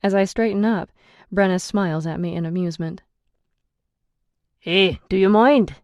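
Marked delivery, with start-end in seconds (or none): 4.6-5.8 loud call down the stairs; calling from downstairs. A narrator's reading. Ian calling downstairs to the card players, raised voice. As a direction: shout it down to the floor below. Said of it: "Ian calls from downstairs".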